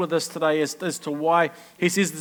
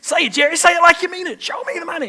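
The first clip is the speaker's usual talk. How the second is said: high, loud hammed-up movie shout; mock Jerry Maguire yelling; movie quote shout; 11 semitones higher, 5 dB louder, faster than usual. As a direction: bellow it like the film scene. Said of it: "I'm like".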